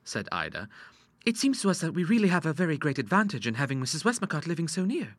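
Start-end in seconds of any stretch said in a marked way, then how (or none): 1.2-5.2 put-on higher girlish voice; young daughter. A narrator's reading. Ida opening her scheme to her father, coaxing.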